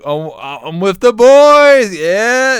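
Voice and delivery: high, loud and drawn-out acted reply; mock rowdy show-off; loud boisterous boast. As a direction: call it out slowly over the phone. Acted reply on in-game video call.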